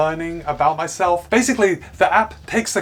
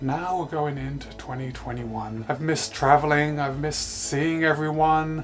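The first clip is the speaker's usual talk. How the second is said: much softer, lower and slow, mopey; mock sad; 4 semitones lower, 6 dB quieter, slower than usual. Shown as a demonstration. Reviewer acting gloomy so the band reads sad, hammed-up sorrow. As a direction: sound glum as if 2020 crushed you. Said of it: "telling me that I'm sad".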